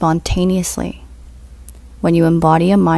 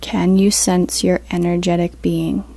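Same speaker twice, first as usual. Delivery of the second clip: guided meditation; 4 dB quieter than usual. Acted. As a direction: ease them into stillness, unhurried.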